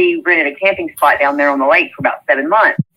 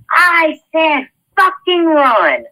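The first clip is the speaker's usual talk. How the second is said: retold scream; 10 semitones higher, much slower than usual. Jeremy orders [name] a second time, slow high mock scream. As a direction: drag out the order, pitched way up. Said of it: "When he screamed at me that second time".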